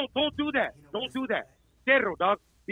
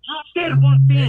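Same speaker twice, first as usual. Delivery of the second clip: louder and slower, hammering each word; loud, slow emphasis; 8 dB louder, slower than usual. Real, not acted. To the rival in a heated argument, loud and slow. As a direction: spells it out loudly to pin him down.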